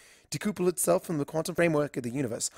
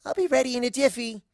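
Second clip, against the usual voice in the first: higher, chirpy pony voice; Ditzy voice; 9 semitones higher, faster than usual. Acted. Ditzy ducks back into the box, chirpy and higher. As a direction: bounce it out happily.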